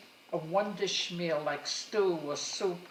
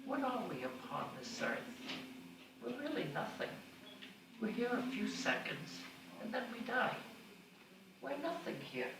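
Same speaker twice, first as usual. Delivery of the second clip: soft musing mother; 4 semitones higher, 7 dB quieter than usual. Retold or imitated. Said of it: "out of the blue".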